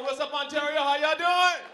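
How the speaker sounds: big hyped greeting; crowd greeting. Real, not acted. Greets crowd again, bigger.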